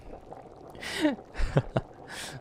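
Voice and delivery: very high breathy titter; vain laugh. Acted. Ancient witch boasting she looks twenty-one, tittering. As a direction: titter at your own vanity.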